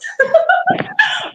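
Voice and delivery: high-pitched giggling fit; laughing. Real, not acted. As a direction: burst out giggling in disbelief. Laughing at her own disbelief.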